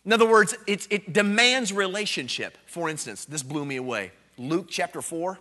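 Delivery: ordinary talk, nothing marked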